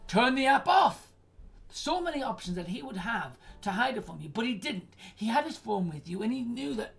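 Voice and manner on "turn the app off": louder and slow, each word hit; slow emphatic command. Real, not acted. Streamer tells the caller what the husband could have done, loud and slow. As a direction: spell it out like an order.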